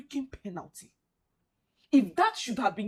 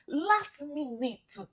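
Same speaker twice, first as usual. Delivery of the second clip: drawn-out despair; 3 semitones higher, much slower than usual. Real, not acted. Repeating in despair.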